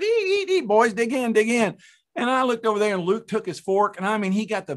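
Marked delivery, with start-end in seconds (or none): 0.0-1.8 high-pitched mock hostess; put-on high voice, eager invitation; imitating eager wife. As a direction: chirp it like a wife urging the family to eat. Voicing wife calling boys to eat.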